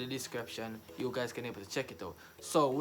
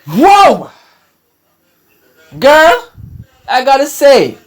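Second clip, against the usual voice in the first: high, loud exclamations of awe; amazed outburst; 10 semitones higher, 12 dB louder, slower than usual. Real, not acted. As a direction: cry out to the singer, blown away. Amazed reaction after the song.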